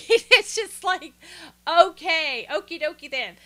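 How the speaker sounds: high, loud, slowed laughing disbelief; real laugh while saying it; laughing exasperation. Real, not acted. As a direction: crack up in disbelief. Exasperated reaction.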